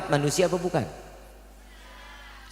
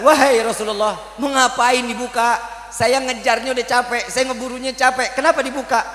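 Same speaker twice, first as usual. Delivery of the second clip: higher, complaining protest; annoyed hunter; 7 semitones higher than usual. Acted. Bedouin hunter protesting to the Prophet, annoyed complaint. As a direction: demand to know why it was freed.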